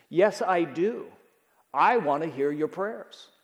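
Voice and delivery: ordinary delivery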